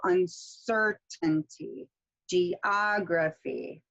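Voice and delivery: very slow "uncertainty" and "geography", stressing CER and OG; antepenultimate stress. Shown as a demonstration. Teacher demonstrating long-word rule, third-to-last syllable stress, slowly. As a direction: draw out un-CER-tainty and ge-OG-raphy for "uncertainty" and "geography". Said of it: "third to the last syllable".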